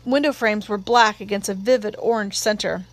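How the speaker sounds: plain reading voice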